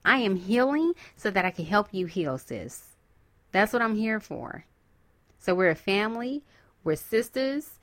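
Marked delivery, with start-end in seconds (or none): 2.4-2.9 whispered sis. Real, not acted. Trailing soft address.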